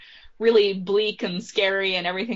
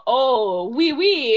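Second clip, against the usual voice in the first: mock French accent; 5 dB louder, much slower than usual. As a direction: put on a token cartoon French accent. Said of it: "like, a French accent".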